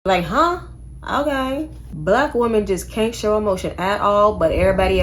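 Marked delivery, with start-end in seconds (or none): 0.0-1.7 mock confusion. Real, not acted. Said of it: "mock confusion".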